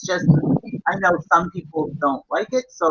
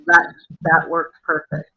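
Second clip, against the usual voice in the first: usual speaking voice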